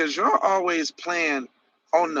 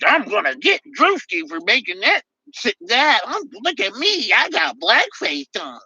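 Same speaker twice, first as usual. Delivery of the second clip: higher mock voice of a clueless wannabe; 5 semitones higher than usual. Streamer mocks a blackface prankster to chat, in a higher voice. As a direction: sneer at him as a goofy try-hard. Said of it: "your boy feeling like he's gotta somehow take up for".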